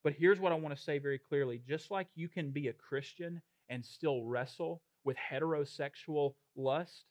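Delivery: ordinary delivery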